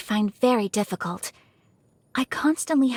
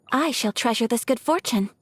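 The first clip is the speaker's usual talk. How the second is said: ordinary talk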